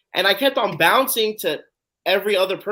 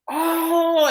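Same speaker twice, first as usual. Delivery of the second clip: high, loud reenacted "oh"; star-struck gasp; 13 semitones higher, 5 dB louder than usual. Fan spots Derrick Henry at the shoot, amazed. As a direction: act out a jolt of amazement, pitch leaping up.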